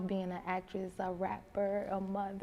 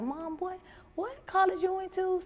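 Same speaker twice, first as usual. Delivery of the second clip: son asking; 12 semitones higher than usual. Retold or imitated. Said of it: "he was like".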